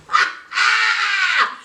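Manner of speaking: high, drawn-out laugh; cracking up. Real, not acted. Host amused by the kids' reactions, cracking up. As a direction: burst into a high real laugh. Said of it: "show cracks me up".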